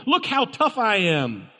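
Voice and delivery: lightly voiced tough talker; imitating a show-off bragging; mock tough guy. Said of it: "it screams for those people".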